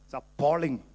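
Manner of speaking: usual speaking voice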